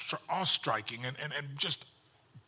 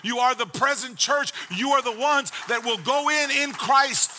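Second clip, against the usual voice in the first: raised, ringing pulpit voice driving each point home; full-force preaching; 8 semitones higher, 6 dB louder than usual. Acted. Pastor preaching to the congregation about the ark, full-force preaching. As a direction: preach it hard, louder and higher, like the room must hear.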